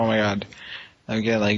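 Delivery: ordinary delivery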